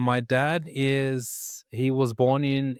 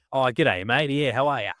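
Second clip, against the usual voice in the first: dad's broad Australian phone greeting; strong Australian accent. Retold or imitated. Son imitating his dad answering the phone, in broad Australian. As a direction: lay the Australian accent on thick. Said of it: "My dad's Australian accent".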